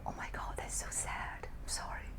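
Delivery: hushed, pitying aside; a little whispered sympathy; mock sad whisper. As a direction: whisper it like sharing bad news.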